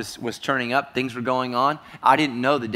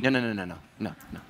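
ordinary talk, nothing marked

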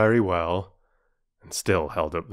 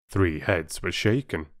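usual speaking voice

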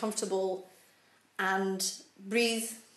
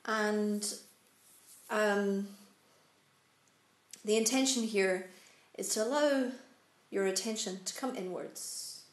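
calm, unhurried meditation guide, long pauses; measured instructions with room to breathe; guided meditation. Real, not acted. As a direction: settle them gently into stillness, phrase by phrase. Leads a seated meditation.

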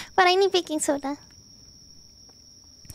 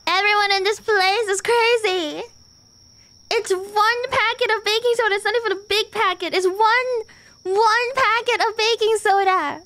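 exasperated rant; 5 semitones higher than usual. Real, not acted. Ranting at game.

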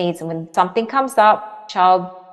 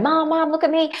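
put-on high childish call for mom; a small child begging to be watched; 10 semitones higher than usual. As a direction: call out like a little kid wanting attention. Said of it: "I used to remember going".

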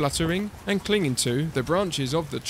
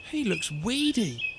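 disapproving mother's grumble, higher pitch; grumbling; 4 semitones higher than usual. A narrator's reading. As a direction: grumble about the disappointing baby. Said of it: "and she grumbled".

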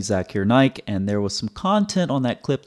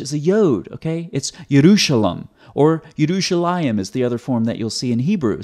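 ordinary talk, nothing marked

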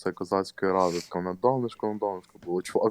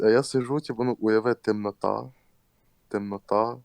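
normal talking voice

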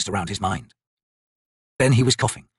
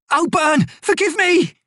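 shrill pleading screams, much higher and louder; desperate high cry; 4 dB louder, slower than usual. Acted. Quick Ben begging his god as he is dragged, screaming.

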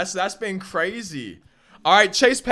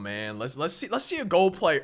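ordinary delivery

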